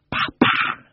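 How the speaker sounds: two voiceless explosive spits; cat spitting. A narrator's reading. Cat spits at the hand under her chin, spitting. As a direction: spit twice like an angry cat. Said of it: "Georgina spat".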